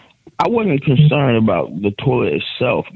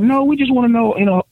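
high prying voice; mock the nosy stranger, pitched way up; 10 semitones higher than usual. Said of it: "They're like".

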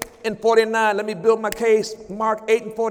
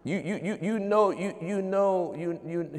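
mock stammering; 3 semitones lower, 10 dB quieter than usual. Stammering doubter imitated.

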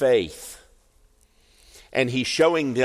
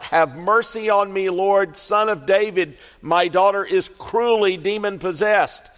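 pleading mother; 8 semitones higher than usual. Acted. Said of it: "she cries out".

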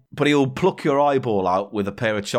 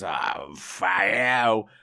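put-on very slow, low drunken yell; dragged-out, lower drunken shout; drunk curse; 4 semitones lower, much slower than usual. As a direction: curse like a drunk at closing time. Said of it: "and he goes".

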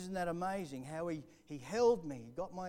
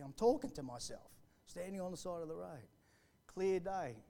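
usual speaking voice

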